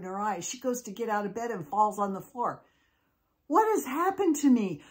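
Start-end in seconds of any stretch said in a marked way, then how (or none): 3.5-4.8 high, louder cry of mild alarm. Acted. Molly falls out of bed changed, alarmed. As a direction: wail it in storybook dismay. Girl wakes up suddenly fat.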